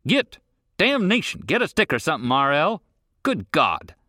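high, loud exasperated yells; frantic yelling. A narrator's reading. Breathless rancher yelling at dogs and son, loud. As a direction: holler at the dogs and his son. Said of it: "he called".

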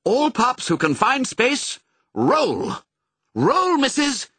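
high, urgent order to the troops; barked command. A narrator's reading. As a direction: call out a command to the whole pack. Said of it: "Pongo barked a command".

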